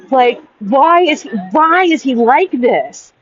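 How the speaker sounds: slow, high-pitched incredulous wail; exasperated. Real, not acted. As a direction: drag out the plea in disbelief. Exasperated plea about the man.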